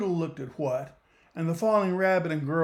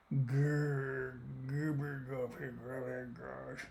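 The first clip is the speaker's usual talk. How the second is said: low growls fading to softer; growling; 4 semitones lower, 11 dB quieter, much slower than usual. A narrator's reading. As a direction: growl on, then let it die away. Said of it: "The monster's growl".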